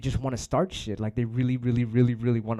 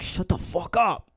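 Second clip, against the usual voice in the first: slow inner annoyance; 7 semitones higher, 3 dB louder, slower than usual. Retold or imitated. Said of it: "in my head, I was like".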